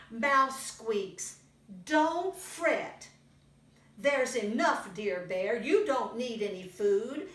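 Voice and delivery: put-on lively read-aloud for little kids; storybook reading. A narrator's reading. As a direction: tell it brightly to a young audience. Reads picture book to children.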